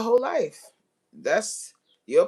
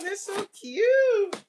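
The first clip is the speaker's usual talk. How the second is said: teary delight; 11 semitones higher, much slower than usual. Real, not acted. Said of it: "I'm so excited".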